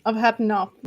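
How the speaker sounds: ordinary delivery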